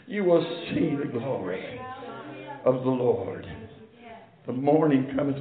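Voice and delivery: low, soft, measured pulpit tone with long pauses; hushed preaching. Real, not acted. Preacher telling what morning means after the dark, hushed preaching. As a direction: drop right down and let each phrase land.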